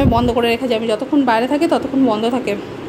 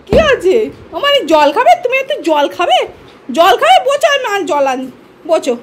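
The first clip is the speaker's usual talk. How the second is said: coaxing pet talk; 7 semitones higher, 4 dB louder than usual. Real, not acted. Offering the pet water, coaxing baby talk. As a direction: coax the little one sweetly, pitched up.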